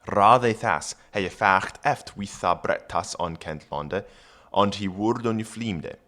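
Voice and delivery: put-on Old English read aloud; careful Old English reading. Shown as a demonstration. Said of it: "reads Old English".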